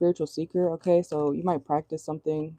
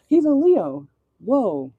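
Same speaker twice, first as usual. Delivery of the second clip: real jolt of surprise, higher and louder; surprised; 6 semitones higher, 7 dB louder, much slower than usual. Real, not acted. Reader finding the card matches a celebrity, surprised exclaim. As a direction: blurt out the discovery. Realizes Patrick Swayze is Leo.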